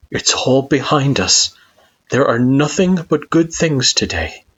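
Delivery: reassuring. A narrator's reading. Kara reassures Frisk.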